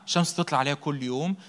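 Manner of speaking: usual speaking voice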